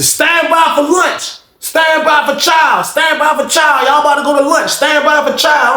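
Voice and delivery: high, slow drawn-out guard call; guard calling chow. Retold or imitated. Guard announcing lunch to the cells, high slow call. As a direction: call it down the tier like a CO.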